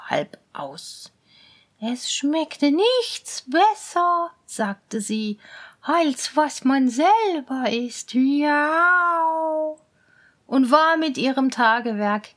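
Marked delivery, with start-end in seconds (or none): 1.8-8.1 slow, relishing cat voice; cat pleased with its theft; gloating cat. Acted. 8.1-9.8 long, pleased meow; contented meow. Acted. Satisfied meow.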